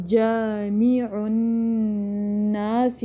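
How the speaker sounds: very slow جامع الناس with long vowels and hummed noon; recited with ghunna. Shown as a demonstration. Teacher recites for students, جامع الناس drawn out with nasal noon. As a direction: hold the doubled noon of جامع الناس in the nose. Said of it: "یہاں پر بھی نون مشدد ہے".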